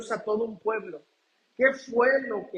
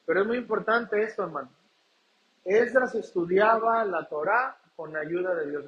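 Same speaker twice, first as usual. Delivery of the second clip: ordinary talk, nothing marked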